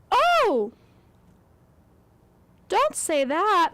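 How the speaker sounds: high, loud, slow stage delivery; theatrical line reading. Retold or imitated. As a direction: overact it like a stage play. Mocking movie's dramatic dialogue.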